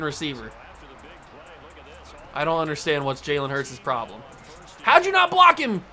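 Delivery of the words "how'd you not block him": high, loud exasperated shout; angry yell. Real, not acted. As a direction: yell at the useless blocker. Yelling at own blocker.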